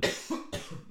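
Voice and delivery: choking cough; real cough after swallowing wrong; coughing fit. Real, not acted. Hat sich ja jetzt verschluckt.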